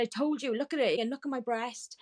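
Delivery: high, indignant demand; retold angry insistence. Retold confrontation with clinic staff.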